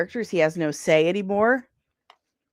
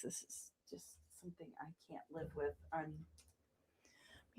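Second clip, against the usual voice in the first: whisper; 6 semitones lower, 29 dB quieter than usual. Real, not acted. Whispered aside while working.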